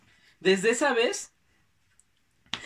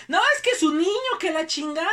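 heated argument; 9 semitones higher, 6 dB louder than usual. Retold or imitated. His mom arguing.

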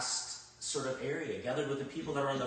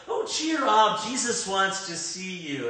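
high, louder fake-sweet cheer; mocking two-faced crowd; 5 semitones higher, 6 dB louder, slower than usual. Preacher mocking the fickle crowd calling the blind man, sarcastic. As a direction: mimic the crowd's sudden fake friendliness. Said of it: "two-faced people or what".

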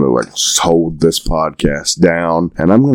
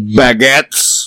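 higher mock French accent on Baguettes; bad French accent; 5 semitones higher than usual. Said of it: "me doing a bad French accent".

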